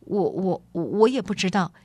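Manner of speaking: stammered, halting start, then a quick finish; stammering. Acted. Boy mistaken for a prince answers the lady, stammering. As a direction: stumble over the first word before getting the answer out. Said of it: "肖士达结结巴巴地回答".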